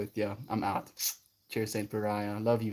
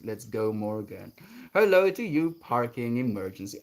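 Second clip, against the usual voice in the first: ordinary talk, nothing marked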